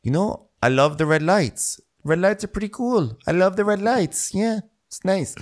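imitating Luke in a spaced-out high voice; dreamy Luke impression. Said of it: "Luke is just in his room going".

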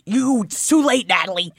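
loud, high gushing; excited shouting. Real, not acted. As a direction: gush over it loudly. Gushing over the chapter.

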